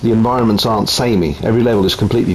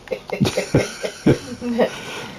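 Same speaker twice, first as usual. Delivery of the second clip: quiet breathy giggle in the background; real laughter; 7 dB quieter than usual. Real, not acted. Wife laughing at her husband's accidental joke, quiet giggle. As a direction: laugh softly at the double meaning. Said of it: "My wife's laughing because I said".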